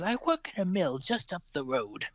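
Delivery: put-on a lighter touch of the high old woman; old woman pointing up the road, lightly voiced; old pie seller. Old woman tells the gnome where she works, lightly voiced crone.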